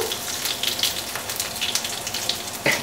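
unvoiced shaky laughter after the scare; soft panting laugh; breathless nervous laugh. Real, not acted. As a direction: laugh off the fright. After being startled by oil.

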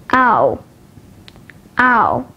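gliding "ow" as in power; isolated "ow", the vowel of hour; diphthong demo. Shown as a demonstration. Teacher showing learners diphthongs, "ow" as a full glide. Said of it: "at the diphthongs".

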